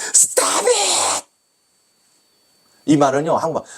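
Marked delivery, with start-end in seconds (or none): 0.1-1.3 sharp high angry "Stop it!", much higher pitch; imitating the opera maestro's high, cutting rebuke. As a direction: bark "Stop it!" like a furious maestro. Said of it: "또 관객들 다 있는 데서 용훈이한테".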